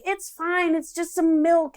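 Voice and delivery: high, slow mock-casual voice; mock laid-back husband brushing off spilled milk; imitating partner. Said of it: "where he's like".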